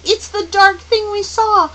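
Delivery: put-on high, loud child's outcry; loud, high-pitched alarm throughout; crying out. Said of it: "Charles Wallace cried".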